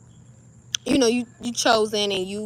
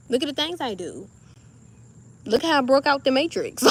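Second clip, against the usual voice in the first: much higher, showing-off sing-song; cocky teasing of an admirer; boastful; 4 semitones higher, 3 dB louder than usual. Retold or imitated. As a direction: strut it, full of yourself. Acts her boastful new self.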